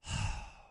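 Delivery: a long unvoiced sigh; tired exasperated sigh; weary sigh. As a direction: breathe out, fed up. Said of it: "And she goes".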